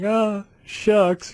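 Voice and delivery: high, aw-shucks delivery; hammed-up coy reply; mock bashful. Retells his reply to a compliment.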